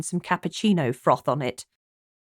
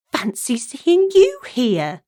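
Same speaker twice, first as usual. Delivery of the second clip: slow, higher, playfully feigned astonishment; mock surprise; 6 semitones higher, 5 dB louder, slower than usual. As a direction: pretend to be amazed, teasing. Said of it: "she said with a mock surprise".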